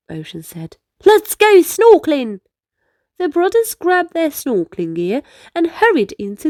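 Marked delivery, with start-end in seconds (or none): 1.1-2.4 put-on excited, louder boy's voice; keen to dive in; eager boy. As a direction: call the idea out brightly.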